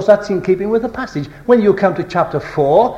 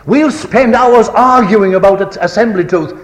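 forceful rebuke; 5 semitones higher, 5 dB louder than usual. Real, not acted. Preacher rebukes the congregation.

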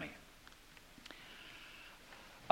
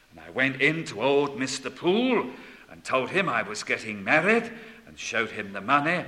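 ordinary talk, nothing marked

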